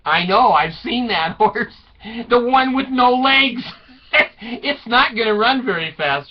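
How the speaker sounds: high, loud, laughing jibe; mock sarcastic retort. Wife scoffing at husband's saddled horse, mock scorn.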